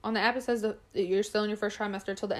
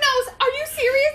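high, loud imitation of crying; hammed-up wailing question; mock tearful disbelief; 8 dB louder than usual. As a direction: imitate someone crying out in shock. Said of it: "she started crying".

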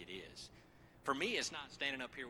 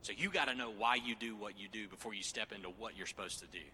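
ordinary talk, nothing marked

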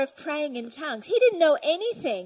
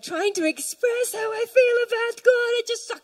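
high, impassioned outpouring; overflowing fervour; 8 semitones higher, 3 dB louder than usual. Acted. Preacher trying to express feeling for God, overflowing fervour. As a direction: let the pitch climb as words fail you. Acting out running out of words.

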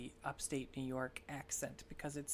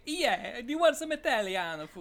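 a hammed-up Italian accent, a bit higher and louder; mock Italian accent; 7 semitones higher, 8 dB louder than usual. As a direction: tease the family with a fake Italian accent. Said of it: "They're not gonna talk like".

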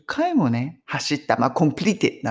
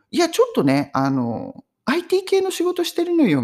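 higher, vague voice of the modest friend; evasive friend; 13 semitones higher than usual. Retold or imitated. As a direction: dodge the question lightly. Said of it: "みたいな感じでこう言葉を濁したりとかして".

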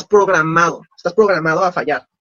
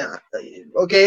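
underwhelmed mumble; 7 semitones lower, 7 dB quieter, slower than usual. Retold or imitated. Underwhelmed reply to a vague answer.

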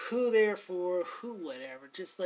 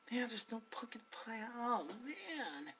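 softer, glum mutter to himself; muttered disappointment; 3 semitones higher, 11 dB quieter than usual. Retold or imitated. Shopper muttering about no pumpkin pie, soft glum grumble. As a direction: grumble under your breath about the empty shelf. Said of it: "she must have overheard me".

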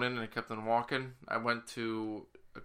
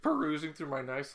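ordinary delivery

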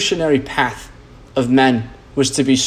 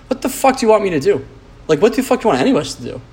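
fast, higher exasperated rant; 7 semitones higher, much faster than usual. Real, not acted. Ranting to listeners about a crying teammate, fast and exasperated. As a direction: throw up your hands, what do you want from me.

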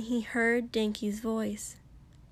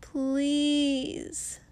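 begging; 5 semitones higher than usual. Acted. Denki begs.

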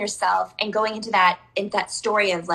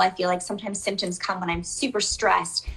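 ordinary talk

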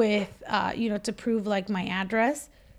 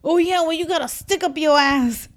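a taunting jab, raised and louder; mocking retort; 5 semitones higher, 5 dB louder than usual. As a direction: toss the insult at the clerk with attitude. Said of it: "then he goes to the D M V man".

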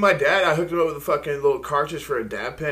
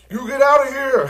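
shouting; 10 semitones higher, 9 dB louder than usual. Retold or imitated. Retelling a homeless guy yelling.

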